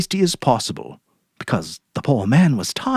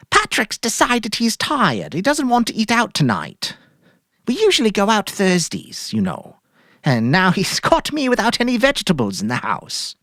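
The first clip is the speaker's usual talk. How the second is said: much higher, airy put-on cheer; forced cheerful wife; 11 semitones higher than usual. Mary ordering groceries from Sam, falsely cheerful. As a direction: play it breezy to hide guilt.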